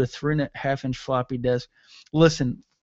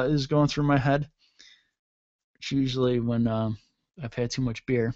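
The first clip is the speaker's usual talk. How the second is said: ordinary talk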